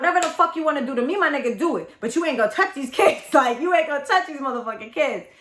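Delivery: replaying losing it to defend the kids; retold outburst. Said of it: "I lost my shit".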